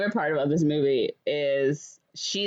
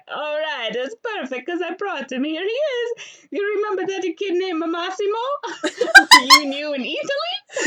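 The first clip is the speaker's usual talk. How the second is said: high, hammed-up Italian accent dad; lightly faked Italian accent, proud dad showing off the groom; mock Italian dad; 14 semitones higher, 7 dB louder than usual. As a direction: play the overexcited Italian father, pitched up. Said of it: "he's like".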